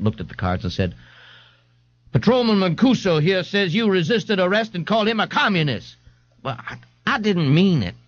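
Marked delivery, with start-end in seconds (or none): 2.1-5.9 higher-pitched sergeant character voice; stern sergeant. A narrator's reading. A sergeant reads the charge to an old man, sternly. 6.4-7.9 higher, mildly sad and halting. A narrator's reading. An old man excuses himself to the sergeant, sadly. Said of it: "the old man said sadly".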